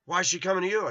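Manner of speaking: high, loud mock challenge; confronting neighbor. Retold neighbor challenging him back.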